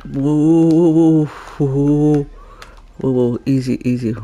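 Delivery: calm a skittish animal down; soothing whoa. Pretend. Calming a spooked animal in game, soothing whoa.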